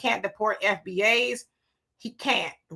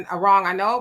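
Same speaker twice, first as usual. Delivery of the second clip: ordinary talk, nothing marked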